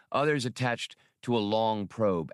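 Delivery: plain reading voice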